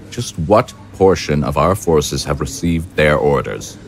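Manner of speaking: normal talking voice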